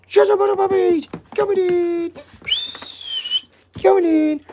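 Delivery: very high, loud pet voice; baby talk to puppies. Acted. Owner herding the puppies in, very high baby talk. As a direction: chirp them inside.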